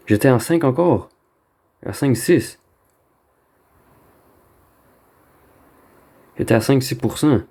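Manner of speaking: high, disbelieving protest; panicking gamer. Real, not acted. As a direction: protest the loss in shock. Just lost at five percent. (joueur paniqué)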